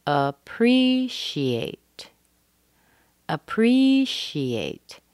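very slow "Appreciate", PRE high and held; primary stress on PRE. Shown as a demonstration. Teacher breaks down the pattern, stress on PRE. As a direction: stretch and lift the second syllable of "Appreciate". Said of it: "the next syllable is a primary stress".

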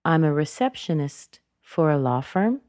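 slight dip after "receptionist", then "firm" goes up; rising end, unfinished. Shown as a demonstration. Teacher demonstrating thought groups, dip on "receptionist", rise on "firm". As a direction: pause briefly after "receptionist", lift "firm". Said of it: "notice after receptionist, she has a slight dropping down".

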